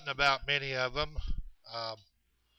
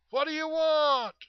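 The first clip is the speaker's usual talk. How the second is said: high-pitched long holler, much higher than talk; hollering back; 4 dB louder than usual. Retold or imitated. Chet answering the voice in the dark, hollering high. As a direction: call it out across the dark woods. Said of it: "And he'd go".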